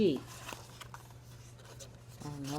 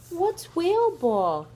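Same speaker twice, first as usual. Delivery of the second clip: high, louder, curious; eager Piggy; 11 semitones higher, 7 dB louder, slower than usual. Acted. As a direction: ask with bright curiosity.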